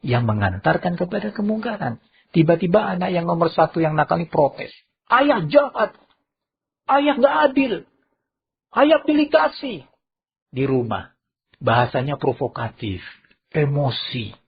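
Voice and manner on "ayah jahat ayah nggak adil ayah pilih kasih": high, whiny accusing voice; a sulky child's high protest; accusing son. Acted. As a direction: wail at father that he's unfair. Naughty son accuses father.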